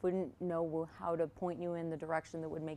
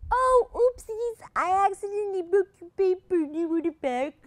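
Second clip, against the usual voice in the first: high squeaky voice; 13 semitones higher, 10 dB louder than usual. Acted. Apologetic booker squeal.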